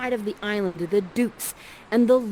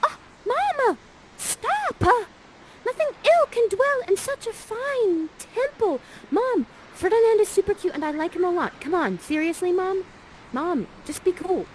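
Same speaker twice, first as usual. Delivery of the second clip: put-on much higher, squeaky teen begging mom; pleading daughter; 11 semitones higher than usual. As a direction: whine at mom to back off. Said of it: "and says this".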